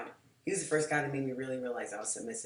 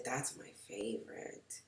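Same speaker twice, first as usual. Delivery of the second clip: soft, slow, breathy confession; breathy whisper; 5 semitones lower, 12 dB quieter, slower than usual. Retold or imitated. Telling her date which house she loves, whispered. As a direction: breathe it like a secret wish.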